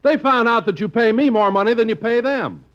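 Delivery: ordinary delivery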